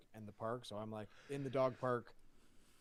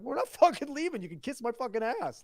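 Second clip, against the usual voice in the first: loud, high, indignant refusal; retold defiant outburst; 10 semitones higher, 9 dB louder than usual. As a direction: re-enact yelling back in a quarrel. Retold defiant quarrel with dad.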